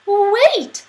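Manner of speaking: high, loud squeak; squeaky mouse. Acted. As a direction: cry wait like a tiny mouse. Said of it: "said the mouse".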